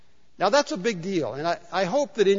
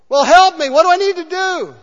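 frantic man; 11 semitones higher, 11 dB louder than usual. Retold or imitated. Man on cliff pleads.

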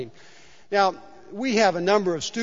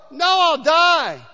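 frantic man; 10 semitones higher, 7 dB louder, much slower than usual. Retold or imitated. Man refuses in terror.

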